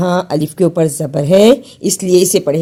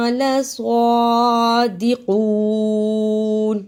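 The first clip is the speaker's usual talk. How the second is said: slow melodic chanted recitation; Quran recitation; 5 semitones higher, slower than usual. Acted. Teacher recites the verse before the lesson, chanted. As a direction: recite it in measured tajweed chant.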